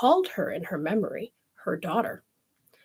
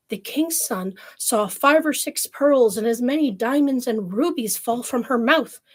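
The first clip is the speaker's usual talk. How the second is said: usual speaking voice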